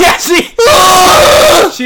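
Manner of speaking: loud high shriek of laughter. Real, not acted. Both friends cracking up over the crash call, loud shrieking laughter. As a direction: burst out in a screaming laugh.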